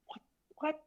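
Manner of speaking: mock bafflement, soft and rising high; baffled reaction. Mixer acting out the artist's puzzled face, baffled. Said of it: "it wasn't a face of disgust".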